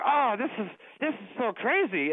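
mock a Russian colleague's amazed accent. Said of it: "she had this outrageous Russian accent".

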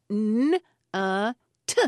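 "N-a-t" as three separate drawn-out sounds, nnn, uh, tuh; sounding out. Retold or imitated. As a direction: sound out "N-a-t" emphatically, sound by sound. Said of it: "he was sounding out the word".

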